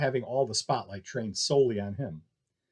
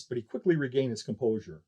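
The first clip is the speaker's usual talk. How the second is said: ordinary delivery, nothing marked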